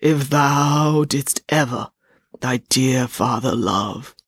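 very slow, drawn-out ghost voice; heavy, solemn ghostly pace; slow, ponderous ghost. A narrator's reading. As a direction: intone it ponderously, stretching each word. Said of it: "The ghost spoke again in a slow, ponderous voice".

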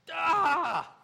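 loud, high cry of frustration; exasperated yell. Acted. Preacher acting out a recurring battle, exasperated yell. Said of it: "and you're like".

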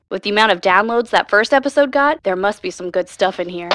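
ordinary delivery

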